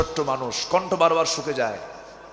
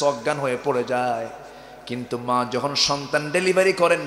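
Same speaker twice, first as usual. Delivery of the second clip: ordinary talk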